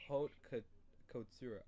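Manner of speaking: "haute cou-, couture" in French-style pieces; French pronunciation. Shown as a demonstration. Teacher modelling the term for a student, French pronunciation. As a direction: say "haute cou-, couture" as "oat koo, koo-TOOR". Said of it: "I'm telling you the French way".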